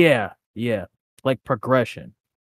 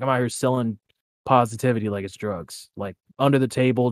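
ordinary delivery

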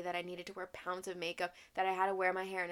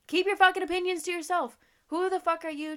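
angry shout; 9 semitones higher, 8 dB louder than usual. Real, not acted. Ranting at body critics.

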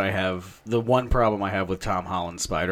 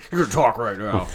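a touch of a gruff interrogation voice; mock interrogation voice; 6 dB louder than usual. Friend imitates Spider-Man's interrogation voice, mildly gruff. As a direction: lightly voice a tough interrogator. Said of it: "Spider-Man's interrogation voice".

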